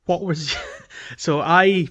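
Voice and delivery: normal talking voice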